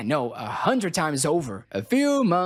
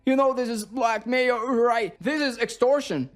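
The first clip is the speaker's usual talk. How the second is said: high, sharp angry retort; indignant father snapping at blackmail; snapping back; 6 semitones higher than usual. Acted. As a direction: snap back at the knight in outrage. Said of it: "His father snaps back".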